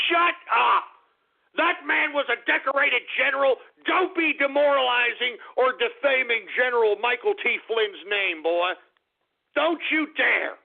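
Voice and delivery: hammed-up fury at a chatter's name; mock outrage. Streamer scolding chat for mocking the general, mock furious.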